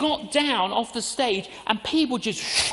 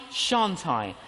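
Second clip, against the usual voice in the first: shan't I, dropped lower; second thought, lower; lower answering doubt; 3 semitones lower than usual. Shown as a demonstration. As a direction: weigh the other side, lower. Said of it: "I get a quiver in my liver".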